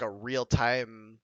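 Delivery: ordinary talk, nothing marked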